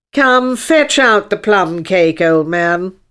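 loud, drawn-out commanding unicorn; bossy unicorn. Acted. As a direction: order the old man about grandly. Unicorn orders the king.

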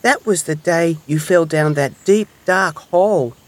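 high, sympathetic storybook hedgehog; little hedgehog. A narrator's reading. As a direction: recall a friend's mishap with pity. Hedgehog recalls Mouse's mishap.

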